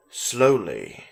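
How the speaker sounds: ordinary delivery, nothing marked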